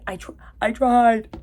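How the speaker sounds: hammed-up anguish with an odd accent; Teresa. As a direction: imitate an overwrought dying-scene plea. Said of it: "the way she said that was so weird".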